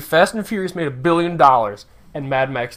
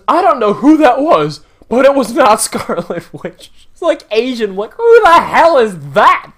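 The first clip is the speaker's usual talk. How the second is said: 1 incredulous; 6 semitones higher, 6 dB louder than usual. Real, not acted. Incredulous rant about casting.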